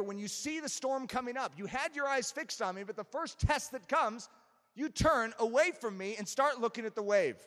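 insistent sermon delivery, building to a cry; forceful preaching. Real, not acted. Preacher voices Jesus challenging Peter, forceful preaching that builds. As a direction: build from challenge to loud exclamation.

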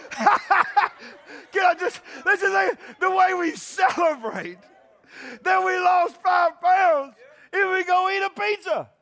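shouted through laughter, high and loud; laughing shout. Real, not acted. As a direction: belt it out while cracking up.

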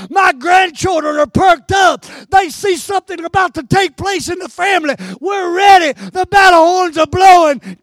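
loud preacher's shout, building line by line; rally the family for battle, shouting; rousing preaching as Caleb. Acted.

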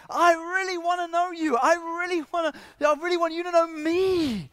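very high, loud, impassioned plea; urgent yearning. Acted. Jesus pleading to be known by Zacchaeus, urgent. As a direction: plead at full voice, longing to be known. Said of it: "There's this urgency".

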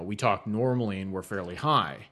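usual speaking voice

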